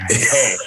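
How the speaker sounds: "Hey." said with a clear breathy H at the start; "Hey." voiced fully with its H sound. Shown as a demonstration. Canadian-American friend shows his blend of sayings, "Hey." with an H. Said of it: "With H".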